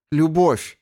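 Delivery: high ringing cry of joy; exultant cry. Acted. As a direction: fling the word out. Gelera's exultant answer.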